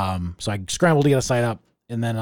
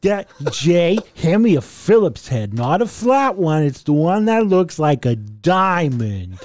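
mock daddy voice; 5 semitones higher, 4 dB louder than usual. Dad asking Jay to help build a machine, mock daddy voice. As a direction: pitch it up and stretch it like a hammed-up dad.

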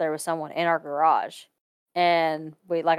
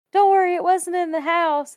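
reassuring waitress; 10 semitones higher, 4 dB louder than usual. Retold or imitated. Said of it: "She was like".

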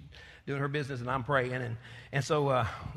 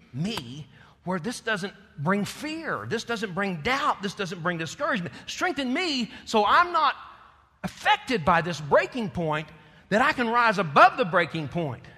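impassioned prayer; 5 semitones higher, 6 dB louder than usual. Acted. Believer praying for strength at the breaking point, rising loud. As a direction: plead for strength, building. Prayer rises to full preaching.

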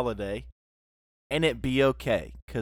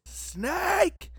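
excited. Pretend. Excited shout of the joke.

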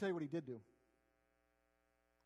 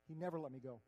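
ordinary talk, nothing marked

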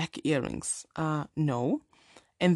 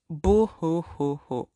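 slow hammed-up mock sobbing; fake crying; slower than usual. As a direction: boo hoo with mock sobs. Mock sobbing at the six pack.

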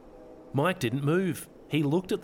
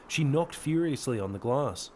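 usual speaking voice

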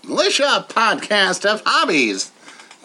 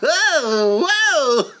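rabbit voice; 9 semitones higher, much slower than usual. Pretend. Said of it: "Robert the Rabbit".

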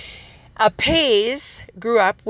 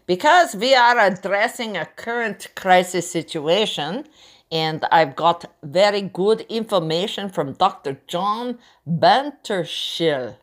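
campy drag queen. Acted. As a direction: purr it like a glamorous drag star. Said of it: "Monique here".

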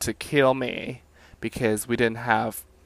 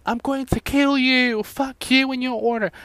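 mock furious retort; 11 semitones higher, 6 dB louder than usual. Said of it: "And I was like".

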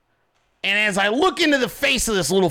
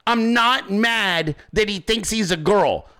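ordinary talk, nothing marked

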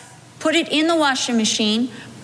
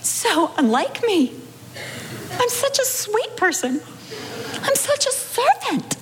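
high, half-crying plea to God, mock-sweet; pleading with the Lord, voice up high and teary; tearful prayer; 6 semitones higher than usual. Wife praying after the quarrel, half crying. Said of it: "saying to the Lord".

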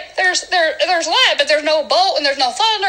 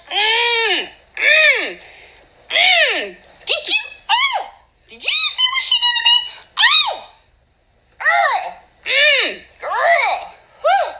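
very high, squealed sassy indignation; mock outrage; 12 semitones higher, slower than usual. God ignored by Nicole, high hammed-up sassy outrage.

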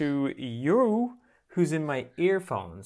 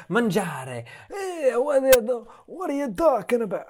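much higher, hammed-up Italian accent; mock Italian local; 12 semitones higher, 4 dB louder than usual. Italian local puzzled by a tourist begging for food, in a hammed-up Italian accent. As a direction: play a baffled Italian local in a thick accent. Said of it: "it's like".

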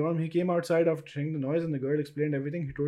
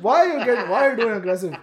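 higher, louder put-on comic voice; mock voice; 7 semitones higher, 6 dB louder than usual. Host voices the confused newcomer, hammed-up and higher.

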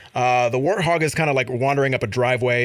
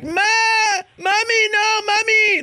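put-on high, loud wailing baby cry for mommy; 3 dB louder, slower than usual. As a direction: wail like a toddler calling mommy. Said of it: "sounds like a human baby being like".

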